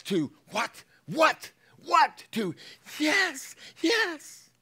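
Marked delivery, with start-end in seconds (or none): 0.5-2.2 much higher, much louder indignant what; mock outraged shout. Older son outraged at the feast, shouting. 3.0-4.5 much higher, louder yes; mock eager yes. Returning to agreement, eager and high.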